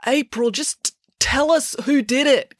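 high, slow call across a crowd. A narrator's reading. Joe calls to April from the stand, urgent. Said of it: "called Joe".

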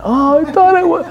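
disappointed churchgoer whining about being pruned; deflated believer. Retold or imitated. Believer deflated after pruning.

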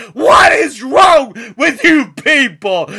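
ordinary delivery

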